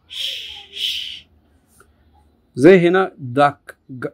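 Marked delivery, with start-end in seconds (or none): 0.1-1.3 ش held as a long hushing sh; sh sound demo. Shown as a demonstration. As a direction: hush out ش, long and soft. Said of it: "لا هي فش". (صوت ش ممدود وهادئ)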